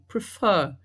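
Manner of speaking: prefer with the second syllable strong; stress on last syllable. Shown as a demonstration. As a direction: hit the end of prefer. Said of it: "A sílaba mais forte é o fã". (prefer com a última sílaba mais forte)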